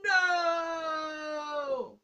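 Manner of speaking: anguished shouted "No!" like Vader; hammed-up Darth Vader cry of no. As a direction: bellow it out in despair, Vader style. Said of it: "Insert Darth Vader".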